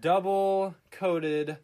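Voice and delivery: drawn out grand reveal; slow announcement. Pretend. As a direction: announce each word slowly. Announcing the snack slowly.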